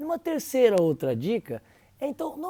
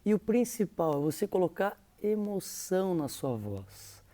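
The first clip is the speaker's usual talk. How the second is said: usual speaking voice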